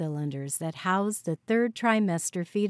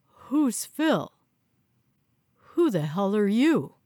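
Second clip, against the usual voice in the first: alarmed gasp; 3 dB louder than usual. A narrator's reading.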